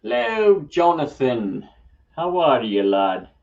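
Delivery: a cheery Scots hello to Jonathan; jokey Scottish accent calling out a hello. Pretend. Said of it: "I have no idea why I did a Scottish accent".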